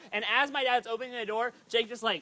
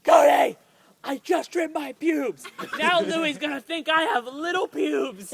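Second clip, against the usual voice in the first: a half-laughing mock yell at his brother; panicked yelp, then laughing complaint; retold scream; 4 semitones higher, 5 dB louder than usual. Teen yelling at his brother over trimmed pubes, mock scream. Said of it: "I heard him scream".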